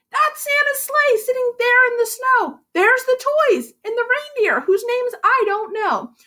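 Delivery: high, light elf voice; a hint of an elf. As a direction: show off the proof eagerly. Elf proving Santa exists.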